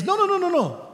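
high, quick string of no's; God calming. Acted. God answering the panicking flesh, high reassuring no's. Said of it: "And he says".

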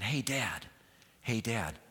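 soft, low call for dad; quiet call, afraid to be overheard; hushed call. Retold or imitated. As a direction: call out timidly, keeping it down. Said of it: "start getting a little bit louder".

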